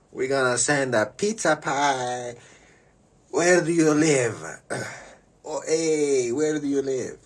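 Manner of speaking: put-on high, loud, slow Italian accent; hammed-up Italian caller asking for the address; Italian pizza man fishing for an address, high and loud. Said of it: "this is Luigi from the Italian restaurant".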